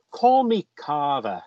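usual speaking voice

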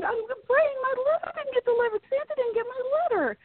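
very high, fast, whiny cartoon sob voice; crying Pinky. Retelling the cartoon scene, high tearful Pinky voice. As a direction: squeak it out like a weepy cartoon mouse. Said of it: "And he's crying. He's like".

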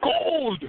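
correcting the host in a mock Irish accent; emphatic correction. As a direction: insist on the word Gold! in mock Irish brogue. Correcting host's mishearing.

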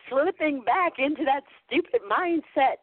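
high-pitched sheepish confession; retold exasperated apology; apologetic. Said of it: "I would almost apologize".

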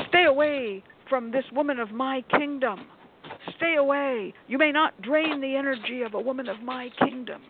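imitating a grandly theatrical warding-off, pitched high; melodramatic movie heroine. Customer warding the shop owner off her client, mock-dramatic and high. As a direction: declaim it like a hammed-up movie protector. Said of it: "like she's in some kind of a movie".

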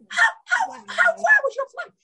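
very high, astonished squeak; stunned disbelief. Retold or imitated. Asking the woman from Botswana, high astonished squeal. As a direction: gasp out the question in shock.

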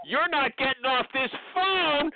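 a recruiter demanding names, pushing hard; pushy. Shown as a demonstration. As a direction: lean on them hard, no nonsense. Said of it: "You gotta get pushy with these people".

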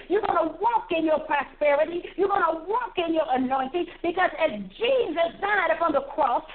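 ordinary talk

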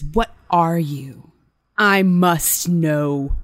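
slow, eerie, drawn-out question; creepy voice. Acted. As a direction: let each word crawl out, unsettling. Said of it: "I'll do this in the creepy voice".

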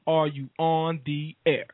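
ordinary talk, nothing marked

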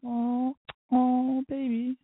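put-on high, gushing oh baby; swooning coo. Reading her sweet text, high swooning coo. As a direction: melt like a smitten teen.

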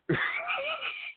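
squealing burst of laughter; high wheezing laugh. Real, not acted. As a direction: shriek with helpless laughter. Laughing fit, stomach hurting.

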